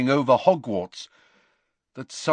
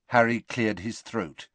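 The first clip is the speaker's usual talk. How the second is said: ordinary delivery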